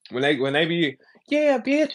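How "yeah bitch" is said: high squealy "Yeah, bitch"; imitating a female rapper's high yelp; mocking girl rapper. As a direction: mimic a girl rapper hyping herself. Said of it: "you gonna sound like them hoes".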